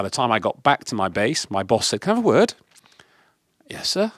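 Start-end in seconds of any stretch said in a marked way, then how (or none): 2.0-2.5 boss summoning. Retold or imitated. 3.7-4.1 high, hushed "yes, sir"; meek whispered reply. Retold or imitated.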